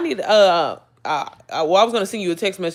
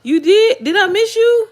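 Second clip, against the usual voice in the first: high, loud delighted surprise; surprised; 14 semitones higher, 9 dB louder, slower than usual. Real, not acted. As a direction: gasp it to a fan in the chat. Reacting to a viewer's comment.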